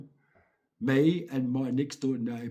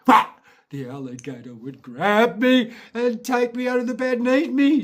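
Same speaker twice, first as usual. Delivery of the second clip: rising higher, louder and faster; mock terror build; 8 semitones higher, 8 dB louder than usual. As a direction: build to a loud, high-pitched scare. Acting out the alligator threat.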